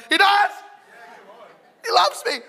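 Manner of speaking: very high, loud gushing outburst; mock ecstatic squeal of belief; won-over listener. Listener swayed by the American preacher, hammed-up excitement.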